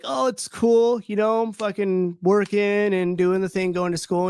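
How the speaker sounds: high put-on casual voice, slowed; fake cheerful. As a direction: shrug it off with forced breeziness. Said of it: "And I'm like".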